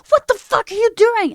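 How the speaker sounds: high, loud mock outrage; outraged roommates. Roommates catching the streamer eating pasta wrong, mock-outraged. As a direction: imitate appalled roommates shouting in disbelief.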